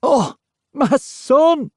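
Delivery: high, loud, slow cry of joy; genuine joy. A narrator's reading. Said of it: "genuine joy in his voice".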